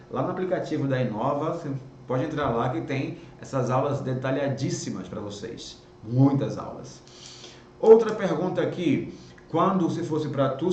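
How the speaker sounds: usual speaking voice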